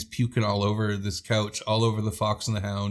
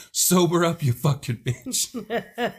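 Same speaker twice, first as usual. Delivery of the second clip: mock furious command; 7 semitones higher, much slower than usual. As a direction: bark it while shoving her under the cold shower, half laughing. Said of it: "She's like".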